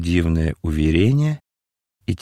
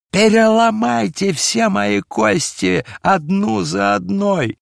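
high, strained, anguished shouting; desperate impassioned wail; hoarse, frenzied cry; 12 semitones higher, 4 dB louder, slower than usual. A narrator's reading. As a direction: beg wildly for punishment at the top of the voice. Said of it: "закричал он хрипло".

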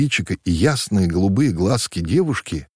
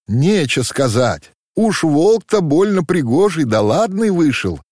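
higher, lightly teasing wisecrack; joking; 8 semitones higher, 4 dB louder than usual. A narrator's reading. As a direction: crack a sly joke about the boy. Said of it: "острила девушка". (шутливо, с лёгкой насмешкой)

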